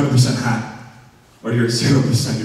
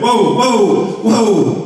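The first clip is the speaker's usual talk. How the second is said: high, loud startled yelps; startled whoa; 7 semitones higher, 3 dB louder, much slower than usual. Retold or imitated. In the shower, startled by water turning hot and cold. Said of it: "like that".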